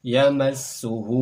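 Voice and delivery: Yamassuhu very slow, ss held; loud, slow Yamassuhu; slow shadda demo. Shown as a demonstration. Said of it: "contoh pelafalan kami".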